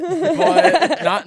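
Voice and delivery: high, loud laugh through "not"; giggling protest. Real, not acted. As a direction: burst out laughing mid-word. Laughing at twelve-year-old fanbase.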